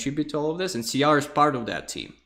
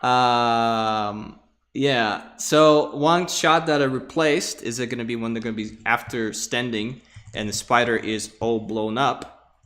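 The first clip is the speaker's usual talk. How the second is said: ordinary delivery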